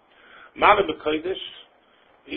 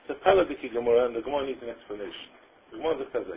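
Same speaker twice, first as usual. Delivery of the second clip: normal talking voice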